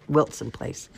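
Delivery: ordinary delivery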